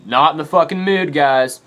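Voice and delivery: annoyed warning. Retold or imitated. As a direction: call it out sharply through the door. Warning friends at shower door.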